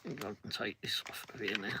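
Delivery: quick unvoiced aside; whisper. Real, not acted. Vlogger whispering an aside while adjusting gear. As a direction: whisper it fast to yourself.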